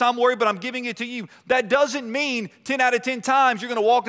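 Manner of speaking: fervent preaching. Acted. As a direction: lift the congregation with conviction. Preaching, voicing the Lord.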